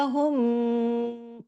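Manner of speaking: हुम with the meem hummed long through the nose; long ghunna. Shown as a demonstration. Teacher showing idgham shafawi, हुम hummed long. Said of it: "फिर जो गुन्ना हमने किया".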